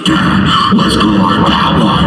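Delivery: low, loud, slow creepy growl; low menacing demonic voice; possessed daughter. Retold or imitated. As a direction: sound like a demon kid craving thrills. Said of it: "and she goes".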